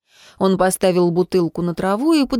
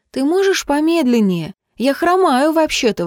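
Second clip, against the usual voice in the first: high-pitched protest; exclaiming; 7 semitones higher than usual. A narrator's reading. Limping girl calling after the man, exclaiming. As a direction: cry out in complaint. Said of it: "воскликнула девушка".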